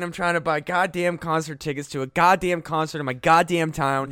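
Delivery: usual speaking voice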